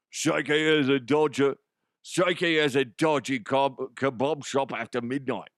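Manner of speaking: broad, hard-to-understand Cockney; thicker Cockney accent. Shown as a demonstration. Host redoing the kebab line to improve it, exaggerated Cockney. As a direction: lay the mock Cockney on thick. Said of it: "make it a little bit harder to understand".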